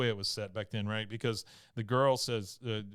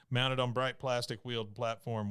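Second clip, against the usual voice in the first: usual speaking voice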